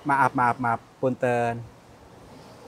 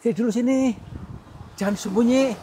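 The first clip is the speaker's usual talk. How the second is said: calling out; 10 semitones higher than usual. Real, not acted. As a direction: call it out from hiding. Calling the hidden spirit.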